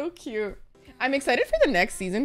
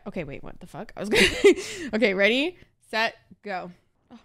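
usual speaking voice

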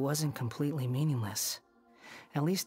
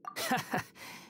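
brief airy chuckle; short scoffing laugh; 10 semitones higher, 3 dB quieter than usual. Acted. Laughing off someone's concern, short airy scoff. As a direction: laugh it off dryly. Dismissive laugh before reply.

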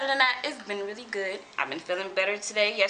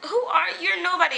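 dismissive; 10 semitones higher, 6 dB louder than usual. Retold or imitated. Retelling dismissive reply.

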